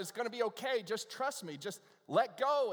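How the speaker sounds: high, loud, insistent urging; storyteller's God coaxing with growing emphasis. Retold or imitated. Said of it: "And God says".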